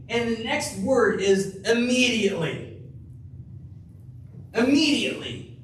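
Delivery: "Immediately" ringing out high; emphatic repeat. Real, not acted. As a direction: hit "Immediately" hard the second time.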